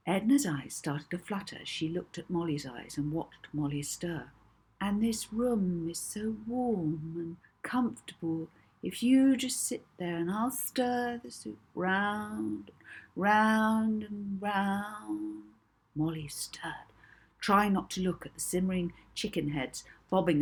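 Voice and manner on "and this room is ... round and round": drawn-out hypnotist's calm; soothing hypnotist voice. Acted. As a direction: lull her under, slowly. Molly hypnotising Edna, soothing.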